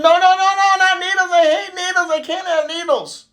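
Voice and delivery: hammed-up child panic, high-pitched; panicked kid protest. Little Johnny refusing the dentist's needle, high acted panic.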